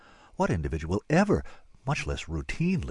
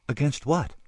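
raised, puzzled question; confused; 6 semitones higher, 4 dB louder than usual. A narrator's reading. Alien asks the warrior about enemies, confused. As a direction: ask it in open bafflement. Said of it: "I emanated confusion".

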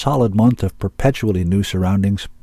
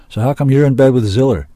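loud, high challenge; demanding; 6 semitones higher, 6 dB louder than usual. A narrator's reading. Zack challenges Finnegan about Ziller, demanding. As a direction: demand an explanation. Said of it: "Zack demanded".

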